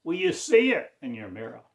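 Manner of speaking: normal talking voice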